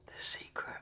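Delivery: whispered, leaning in close; breathy conspiratorial whisper, almost no voice. Acted. Whispering the secret to viewers leaning in.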